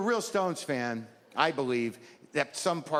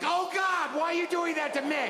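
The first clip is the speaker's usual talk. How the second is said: high-pitched, anguished outcry; hammed-up dismay, pitched way up; horrified plea; 13 semitones higher than usual. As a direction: wail it in mock horror. Acted horror at the big screen.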